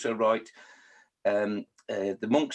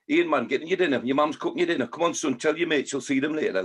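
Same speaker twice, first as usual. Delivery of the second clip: Geordie mum calling; 3 semitones higher than usual. Retold or imitated. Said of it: "it'd be".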